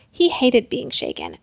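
ordinary delivery, nothing marked

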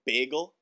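"Bagel" with a long a, as in bay; unsure, questioning "BAY-gel"; standard pronunciation. Retold or imitated. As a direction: try "Bagel" the New York way, BAY-gel. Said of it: "They're like".

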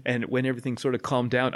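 normal talking voice

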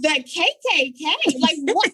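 stunned question; retold shocked what; mock disbelief.

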